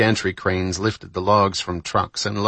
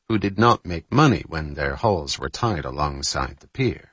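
ordinary delivery, nothing marked

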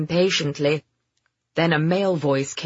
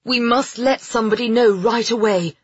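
raised, urgent voice; alarmed and higher, not shouting; 6 semitones higher, 4 dB louder than usual. A narrator's reading. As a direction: press for action at once. Alarmed, rushing off to warn.

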